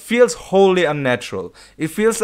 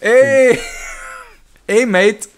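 a lightly put-on Australian greeting, pitched up; Australian accent; 6 semitones higher, much slower than usual. Mocking a movie character's sudden accent, lightly Australian. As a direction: greet like a cheery Aussie bloke. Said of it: "That's Australian".

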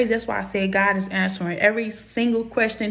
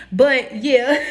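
high-pitched real laugh; 5 semitones higher than usual. Real, not acted. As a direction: laugh at your own joke. I am so freaking corny.

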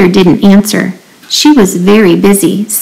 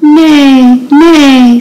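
high, drawn-out spoken neigh; horse neigh; 5 semitones higher than usual. A narrator's reading. Horse calling to the busy spider, high neighing. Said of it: "said the horse".

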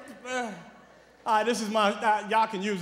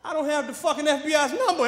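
ordinary talk

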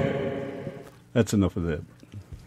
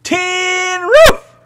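shouted lyric; 8 dB louder than usual. Real, not acted.

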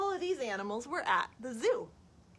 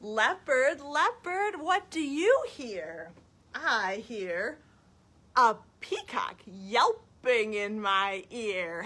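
put-on reading to children; 2 semitones higher, 5 dB louder, much slower than usual. Storytime question and answer about an elephant, lilting. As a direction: chant the question, stretch the answer. Reads picture book to children.

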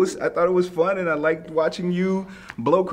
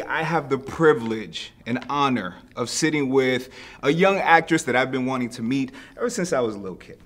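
usual speaking voice

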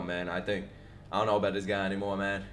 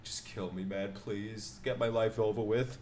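mock resigned plea; 2 semitones lower, 7 dB quieter than usual. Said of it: "This guy was like".

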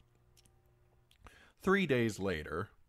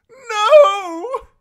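long, very high, loud wail; desperate shout; 20 dB louder than usual. A narrator's reading. Prisoner shouts as the door slams on him, desperate. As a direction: shout it out at full force. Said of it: "he shouted".

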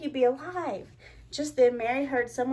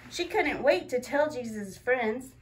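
usual speaking voice

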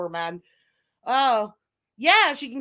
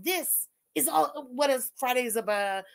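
usual speaking voice

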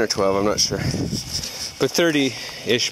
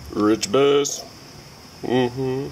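ordinary talk, nothing marked

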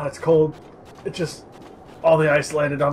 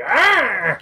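sharp high shriek; imitated lizard roar; 10 semitones higher, 7 dB louder than usual. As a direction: squawk it like a rearing beast. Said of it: "just going like".

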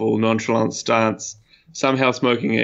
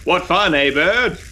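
jaunty higher-pitched call; 7 semitones higher than usual. Acted. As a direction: call over your shoulder, voice rising at the end. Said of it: "Jeffrey calls".

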